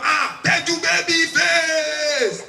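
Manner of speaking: loud, high, drawn-out hail; hailing shout. Retold or imitated. Street touts hail the comedian, loud drawn-out shout. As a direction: shout it slowly across the street, every word stretched.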